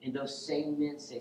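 usual speaking voice

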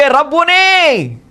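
long, high drawn-out cry. Acted. Mary recognizes Jesus and cries out, high and slow. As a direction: stretch each word as a joyful call. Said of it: "అని కేకలు వేస్తుంది".

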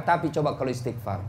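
usual speaking voice

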